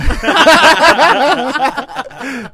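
long, loud, high belly laugh; big laugh. Real, not acted. Guest cracking up at host's joke, roaring laughter. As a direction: roar with laughter for a few seconds.

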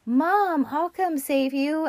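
high mock child voice, calmly sure of itself; creepy child. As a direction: play the eerie five-year-old. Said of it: "this creepy five-year-old is just like".

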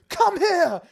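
high, overacted Quirrell yell; dramatic villain shout. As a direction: ham up the villain's command. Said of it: "such a dramatic line read of Quirrell".

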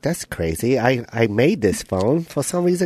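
slow internal monologue in a mock Chinese accent; low, puzzled musing in put-on Chinese accent. Restaurant worker puzzling over a phone, mock Chinese accent. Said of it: "you were doing his internal monologue".

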